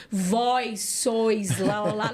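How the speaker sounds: very slow, mock-archaic biblical drone; mock solemn Jesus. The Jesus she had expected, mock-solemn slow drone. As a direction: drag it out like a pompous old scripture reading. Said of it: "eu achava que ele falaria assim".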